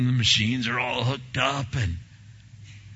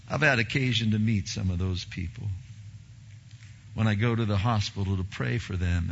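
ordinary talk

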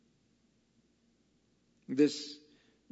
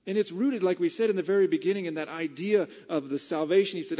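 ordinary talk, nothing marked

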